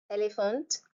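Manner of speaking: ordinary talk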